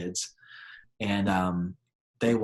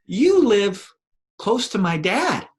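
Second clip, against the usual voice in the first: put-on much higher, louder little boy; small child voice; 10 semitones higher, 8 dB louder, slower than usual. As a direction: play a bold little kid.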